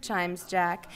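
ordinary delivery

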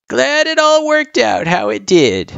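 put-on high creaky old turtle, chuckling; a jolly grandpa chatting with a kid; old man voice. Gerson replies.

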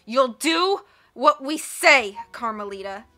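slow, loud menacing growl. A narrator's reading. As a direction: growl the order out. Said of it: "Olaf growled".

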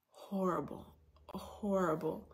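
soft, slow whispered "horrible"; hushed, drained "horrible, horrible"; whispered misery. Retold or imitated. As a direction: breathe it out like you're wiped out. Feeling awful from sickness.

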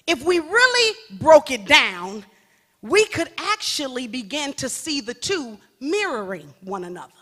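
ordinary talk, nothing marked

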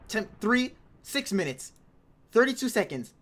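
usual speaking voice

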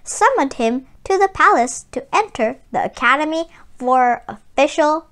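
calm storybook reading, evenly paced; story narration. A narrator's reading. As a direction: read it like a picture book to children. Reads the story aloud.

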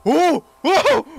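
real high, loud shriek of shock; startled scream. Real, not acted. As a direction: cry out, suddenly startled. Startled by figure in video.

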